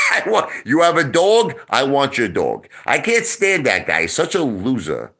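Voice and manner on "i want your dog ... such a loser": ordinary delivery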